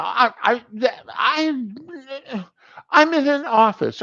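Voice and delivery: high-pitched stammer, acted fluster; flustered protest. Patient objecting to doctor about the office, mock flustered.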